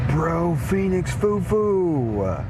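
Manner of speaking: slow, stretched shout-out name; drawn-out greeting. Real, not acted. Streamer greets new supporter.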